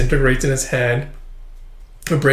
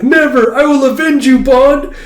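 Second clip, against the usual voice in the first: high, loud vow of vengeance; dramatic defiance; 12 semitones higher, 7 dB louder than usual. Retold or imitated. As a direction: cry it out like a melodramatic hero. Toy-gun villain cry in retold scene.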